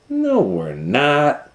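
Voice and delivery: slow, high reassuring reply; calm little sister. Acted. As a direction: gently correct her sister. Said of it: "said Louise".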